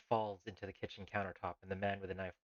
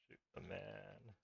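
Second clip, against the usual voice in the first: dictating softly; 14 dB quieter, much slower than usual. Shown as a demonstration. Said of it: "No, shoot the man holding the knife".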